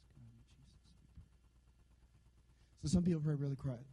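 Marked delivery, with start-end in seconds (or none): 0.0-0.8 mock whispered prayer. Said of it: "And then there's people".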